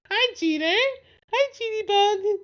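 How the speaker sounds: high sing-song greeting to a dog. Real, not acted. Talking to the dog.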